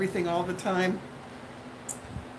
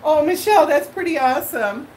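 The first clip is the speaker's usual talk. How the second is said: excited; 6 semitones higher, 7 dB louder than usual. Real, not acted. Excited reaction to a viewer.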